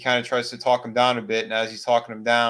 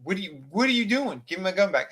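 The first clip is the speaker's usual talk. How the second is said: higher, insistent retold demand; alarmed demand; 7 semitones higher, faster than usual. Russell demanding his rifle back, higher alarmed voice. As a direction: want the gun back now.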